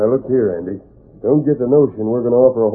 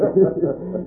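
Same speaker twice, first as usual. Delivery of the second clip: gloating chuckle; 7 semitones higher than usual. Acted. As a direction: sly chuckle. Crooks gloat over their swindle.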